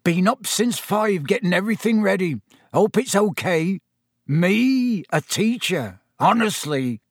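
high, eager proud chatter; bursting with pride; a new teacher excited about his first lesson. A narrator's reading.

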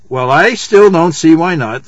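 slow, loud plaintive complaint; drawn-out, plaintive whine of protest. A narrator's reading. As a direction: drag it out as a child's plaintive objection. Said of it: "said Winnie plaintively".

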